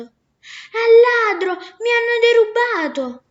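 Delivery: the robbed merchant bawls to the whole street; screaming for help. Acted. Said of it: "uscì urlando".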